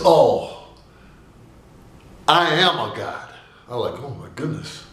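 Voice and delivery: ordinary delivery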